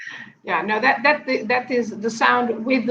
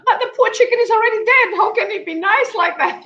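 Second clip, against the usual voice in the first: quoting the laughing hosts; 10 semitones higher, faster than usual. Retold or imitated. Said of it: "they said".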